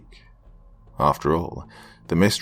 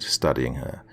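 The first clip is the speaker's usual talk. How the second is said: ordinary delivery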